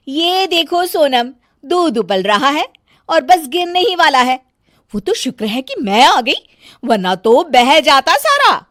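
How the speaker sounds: high, loud complaining bahu voice; bossy bahu fussing over nothing; nagging daughter-in-law. Acted. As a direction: scold the whole family, shrill with irritation. Megha scolds over milk.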